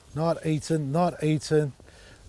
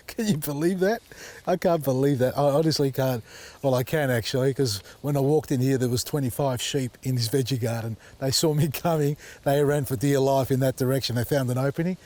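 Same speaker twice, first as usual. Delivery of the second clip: normal talking voice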